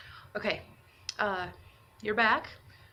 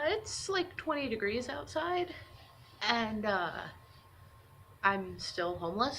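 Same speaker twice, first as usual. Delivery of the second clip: normal talking voice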